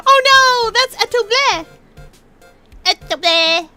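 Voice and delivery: high, loud alarmed cry; alarmed exclamation. Acted. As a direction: shout out in alarm.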